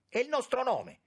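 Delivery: very high, loud cry. A narrator's reading. Young man pleads for family honour to the minister, crying out. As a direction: cry it out in protest. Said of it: "A questo grido".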